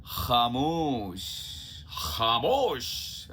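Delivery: खामोश very slow, throaty ख; slow nukta kh. Shown as a demonstration. Teacher slowly demonstrating खामोश. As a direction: stretch खामोश with a rasping ख.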